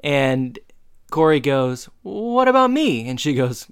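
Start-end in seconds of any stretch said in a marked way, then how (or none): 2.0-3.1 high, loud indignant what about me; indignant protest. Retold or imitated. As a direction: cut in, offended. Corey protests sister's boast.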